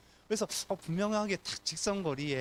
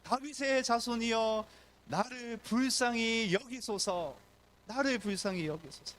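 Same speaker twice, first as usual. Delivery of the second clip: crying out; 8 semitones higher, slower than usual. Acted. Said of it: "외칩니다".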